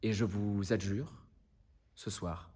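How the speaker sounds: low, hushed plea sinking towards a near whisper; grave closing words with long pauses, fading away; solemn appeal. Acted. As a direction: plead from the heart, softly and gravely. Lawyer's solemn final appeal to jury.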